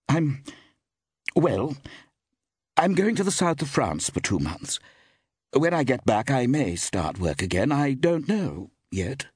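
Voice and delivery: high, hesitant explanation with halting start; hesitate, then explain plans without certainty; uncertain. A narrator's reading. Actress explaining her trip to France, uncertain. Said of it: "replied Iris uncertainly".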